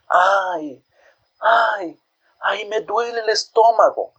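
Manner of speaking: high, moaning cries of pain; mother in labour pain. A narrator's reading. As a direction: groan it out like a woman in labour. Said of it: "dijo doña Ruth".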